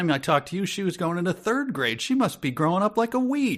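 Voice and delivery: friendly mafia don glad-handing, pitch climbing; chummy small-talk voice. Retold or imitated. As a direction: gush over their kids like a glad-handing neighbor. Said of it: "to go".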